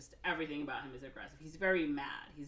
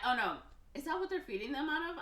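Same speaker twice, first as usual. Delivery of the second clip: surprised; 9 semitones higher, faster than usual. Real, not acted. Speaker suddenly realizes a gross detail.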